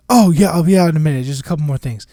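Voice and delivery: loud, quick brush-off; calling out. Retold or imitated. Employee answering boss from the bathroom, calling out. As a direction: call back hurriedly through a door. Retold call back through bathroom door.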